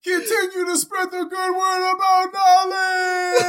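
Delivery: hammed-up dying farewell, high and crying; wounded soldier gasping. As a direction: wail your last words while falling. Mock fall off cliff, last words.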